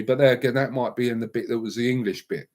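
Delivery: ordinary delivery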